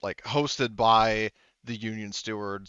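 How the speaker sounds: usual speaking voice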